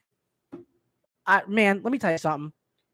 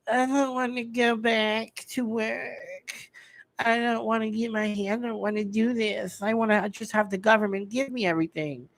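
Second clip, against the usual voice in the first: mock whining slacker; 3 dB quieter than usual. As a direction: imitate someone too lazy to work. Mimicking lazy people refusing work.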